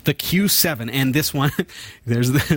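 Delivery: ordinary talk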